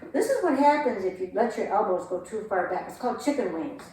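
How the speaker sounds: usual speaking voice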